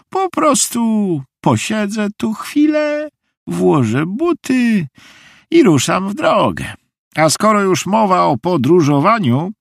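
very high, slow character voice; high-pitched, unhurried lady; eccentric visiting lady. A narrator's reading. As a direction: announce it high and drawn-out, as the odd guest.

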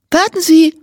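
high, eager call after a reporter; an excited girl calls to a reporter. A narrator's reading. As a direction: stop him before he leaves. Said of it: "ruft sie einem Reporter zu".

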